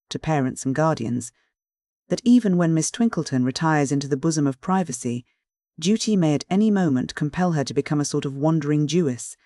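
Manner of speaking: usual speaking voice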